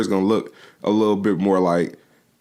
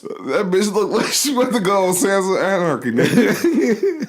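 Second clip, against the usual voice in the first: high, laughing through the words; 12 semitones higher than usual. Real, not acted. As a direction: crack up while talking. Cracking up.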